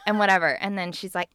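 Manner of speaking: ordinary talk, nothing marked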